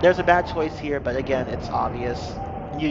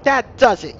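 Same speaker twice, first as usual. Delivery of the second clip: angry; 8 semitones higher, 7 dB louder than usual. Acted. Angry story line, loud.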